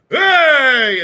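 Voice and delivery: loud, high shout of greeting; drunken cheer. Acted. Drunk dwarf and elf shouting hello to the party, loud cheer. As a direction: bellow it like a merry drunk landing a fall. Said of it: "they both go".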